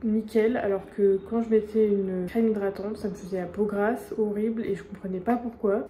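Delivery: usual speaking voice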